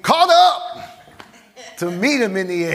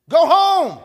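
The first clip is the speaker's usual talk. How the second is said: much higher and louder cry; go home; 12 semitones higher, 5 dB louder than usual. Acted. Preacher crying out to the dying to go home. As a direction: call it out with conviction. Urging the weary sick to rest.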